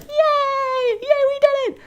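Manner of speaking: very high cartoon cheer; high-pitched victory squeal; squeaky cheer. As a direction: cheer like tiny elves. Elves cheering.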